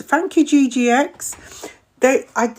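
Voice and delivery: normal talking voice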